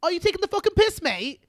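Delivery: loud, high mock Geordie rant; Newcastle accent. Asked for Newcastle, an angry Geordie accent. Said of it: "like, Newcastle".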